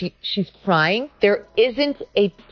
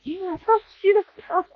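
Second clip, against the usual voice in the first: high, halting fake gushing; mock inauthentic reaction; 10 semitones higher than usual. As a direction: imitate her insincere cooing, with pauses. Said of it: "it's so inauthentic".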